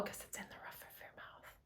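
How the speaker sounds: soft unvoiced whisper. Real, not acted. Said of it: "whisper while demonstrating".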